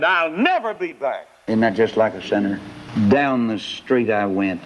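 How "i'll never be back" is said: defiant huff. Acted. Sinner storms out on doctor.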